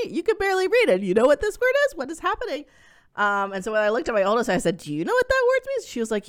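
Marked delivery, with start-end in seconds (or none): 0.1-2.6 much higher, stunned disbelief; can't believe what she's hearing; incredulous. Retold or imitated. Mom reacting to her six-year-old spelling a swear, incredulous. 4.8-5.9 much higher, startled asking; shocked she has to ask; alarmed question. Retold or imitated. Mom asking her oldest about the bad word, startled.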